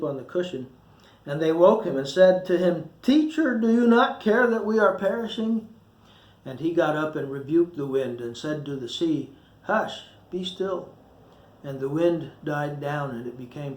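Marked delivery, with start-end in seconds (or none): 3.0-5.7 high, pleading cry for help; desperate plea over the storm; frightened cry. Acted. Frightened disciples wake Jesus in storm. 9.6-10.9 soft, slow, soothing hush; quiet the storm gently; hushed and slow, settling the sea; calm command. Acted. Jesus calms the sea.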